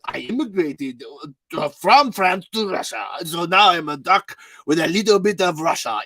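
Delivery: put-on muddled Russian accent slipping into French; hammed-up improv waiter with a confused Franco-Russian accent; Russian duck waiter. Improv duck waiter taking an order, muddled Russian-French accent. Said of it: "a Russian duck that's a waiter".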